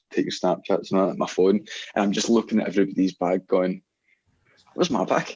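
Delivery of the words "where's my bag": high-pitched retold moment of alarm; sudden realisation. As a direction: act out suddenly missing your bag. Suddenly noticing the missing bag.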